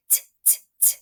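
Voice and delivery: crisp unvoiced t taps; t sound. Shown as a demonstration. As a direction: tap out the t. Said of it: "What sound?".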